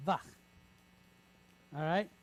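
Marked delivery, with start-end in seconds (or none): 0.1-0.2 Vach with a short a and a throaty ch; syllable vach. Shown as a demonstration. Teacher sounding out Vach with a guttural ch. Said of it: "short duration A with a chet behind it".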